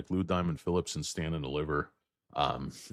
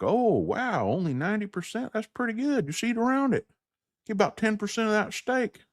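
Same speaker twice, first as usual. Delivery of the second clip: very high cartoonish voice; squeaky-high put-on brush-off; dismissive steak server. Server answering a diner's gristle complaint, very high mock voice. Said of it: "He's like".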